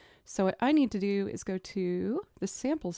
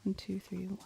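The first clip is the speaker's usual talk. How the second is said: soft whispered one two three, fading away; whispered count-in; 9 dB quieter than usual. Real, not acted. Producer counting the waltz beat under her breath, whispered. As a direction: whisper the count to yourself, trailing off. Count-in before the drum sample.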